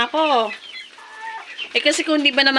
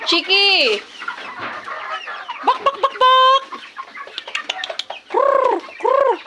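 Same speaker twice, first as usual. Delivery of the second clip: high clucking bak then rolled krr trill; high clucks and trills; 12 semitones higher, slower than usual. Real, not acted. Vlogger luring her chickens with clucks and trills. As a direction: cluck and trill to lure the hens.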